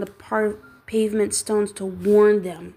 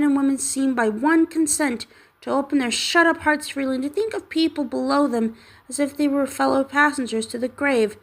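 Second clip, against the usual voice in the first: higher, warm earnest plea; earnest nephew; 5 semitones higher than usual. Acted. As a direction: speak from the heart for Christmas. Nephew's long Christmas speech.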